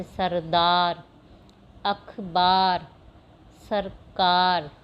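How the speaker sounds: very slow, each word drawn out; slow model reading. Shown as a demonstration. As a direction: read the long words slowly for pupils to copy. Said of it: "आपको इनकी practice करनी है". (धीरे-धीरे पढ़े गए लंबे शब्द)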